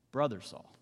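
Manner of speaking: low, tender greeting; affectionate. Acted. Ananias greets blinded Saul, low and affectionate. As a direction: say it gently, as to a brother. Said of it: "that's a sign of affection".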